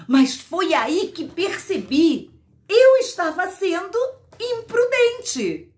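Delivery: storyteller's rising alarm; alarmed realization. Acted. Heroine realizes she was imprudent.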